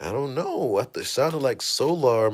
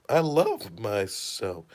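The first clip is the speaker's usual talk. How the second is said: mild hushed musing; 4 semitones lower, 6 dB quieter, slower than usual. Retold or imitated. Thief mumbling at mirror.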